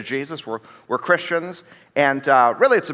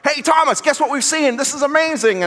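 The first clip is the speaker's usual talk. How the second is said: high-pitched, eager calling to a friend; thrilled disciple, voice jumping up; pumped up, excited; 11 semitones higher than usual. Acted. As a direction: spill amazing news excitedly. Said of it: "they're excited".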